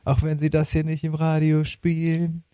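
usual speaking voice

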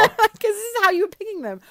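high, loud words through laughter; laughing. Real, not acted. Laughing while speaking.

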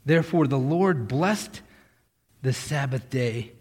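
ordinary delivery

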